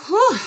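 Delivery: a sharp, high exhaled whew; relieved whew. Real, not acted. Tarot reader catching her breath after ranting at viewers, loud whew. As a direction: blow out a big relieved whew.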